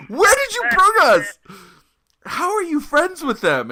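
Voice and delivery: very high, loud incredulous shriek; whisper shrieking. Retold or imitated. Bandmate confronts singer over the Nazis, mock whisper-shriek. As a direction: demand answers in a strained mock shriek. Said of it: "I am literally whisper shrieking at him".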